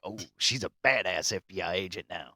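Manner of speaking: sarcastic, unimpressed aside; mock viewer reaction. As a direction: roll your eyes at the plot twist. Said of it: "And you're like".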